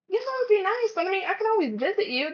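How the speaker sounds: sweetly sidestep the guilt trip; polite brush-off. Retold or imitated. Employee politely deflecting a clingy relative, high sing-song.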